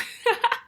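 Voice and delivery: short high-pitched laugh; real burst of amused laughter; quick laugh at her own aside. Real, not acted. Reacts to the dirty blonde detail.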